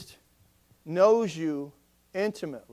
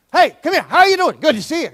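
higher, louder, bouncy hello; eager loud greeting; 7 semitones higher, 9 dB louder, faster than usual. Retold or imitated. Pastor imitates his own eager greeting at the door. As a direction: burst in like an overeager friend. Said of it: "I'm all like".

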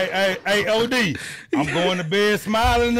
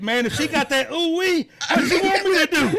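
higher-pitched, animated quote; Shannon Sharpe; 6 semitones higher than usual. Retold or imitated. Podcast host quoting a sports personality to friends, lightly mimicked. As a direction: lightly imitate a man's animated question. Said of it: "Shannon Sharpe said".

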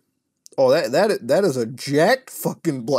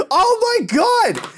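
exasperated shout; 5 dB louder, slower than usual. Real, not acted. Another ad pops up.

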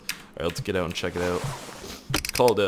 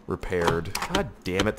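ordinary delivery

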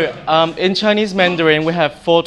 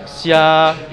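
"xia" stretched out on a level pitch; long flat tone. Shown as a demonstration. Teacher showing the longer first tone, "xia" held level. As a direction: hold "xia" longer without letting it move. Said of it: "it goes a bit longer".

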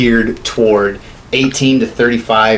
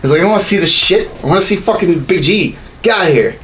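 higher, heated venting at the ads; fed-up rant; 7 semitones higher than usual. Real, not acted. Venting to his co-host about Pikachu ads, heated and exasperated. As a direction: blow off steam, exasperated.

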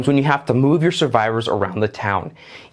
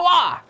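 startle shout. Retold or imitated. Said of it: "and then go".

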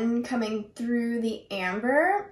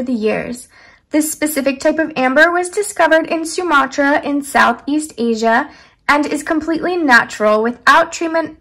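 louder, projected scripted product read; reading aloud; 9 dB louder than usual. Acted. Vlogger reads the pendant's description card to viewers, loud read-aloud voice. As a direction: read the write-up out loud and evenly.

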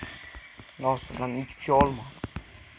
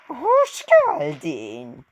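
very slow, high-pitched mock welcome; put-on character greeting; 9 semitones higher, much slower than usual. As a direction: squeal the welcome high, stretching each word. Acts the boss greeting back.

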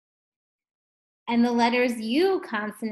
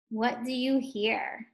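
ordinary talk

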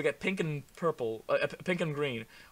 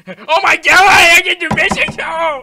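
very loud, high, drawn-out scream; excited shriek; 25 dB louder than usual. Real, not acted. Player thrilled by mistletoe earrings, shrieking. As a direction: shriek it through bursting laughter.

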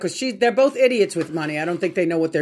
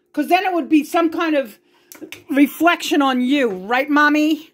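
angry rant; 9 semitones higher than usual. Real, not acted. Daughter furiously confronting her absent mother. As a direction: let the anger pour out, rising.

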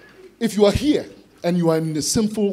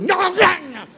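snarling dog attack; 13 semitones higher, much slower than usual. Acted. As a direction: snarl like a dog going for a bite. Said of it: "and the dog goes".

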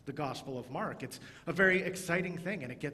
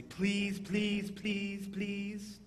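pleading please; 6 semitones higher, much slower than usual. Shown as a demonstration. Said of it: "like if you were asking your parents for something".

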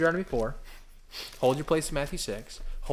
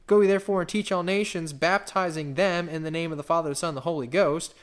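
louder, emphatic verse reading; scripture reading; 4 semitones higher, 6 dB louder than usual. Acted. Preacher proclaiming scripture to the congregation, emphatic. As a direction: proclaim it to the room.

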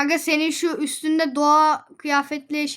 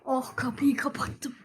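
whisper; 3 semitones lower, 8 dB quieter than usual. Real, not acted. Door closed, speaking hushed.

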